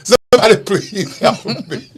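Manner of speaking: hammed-up panicked man crying for help; mock cry for help. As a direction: beg for help while cracking up.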